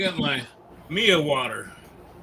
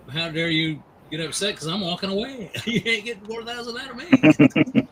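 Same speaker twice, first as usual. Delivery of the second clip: mock indignant comeback, rising and louder, laughing at the end; defiant retort; 6 semitones higher, 5 dB louder than usual. To the knife seller, laughing defiance. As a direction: brush him off while laughing. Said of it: "And I thought".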